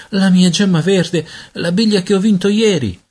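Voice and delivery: put-on high-pitched wail of a boy; distressed protest. A narrator's reading. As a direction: plead in high, upset tones. Peter distressed over his lost marble.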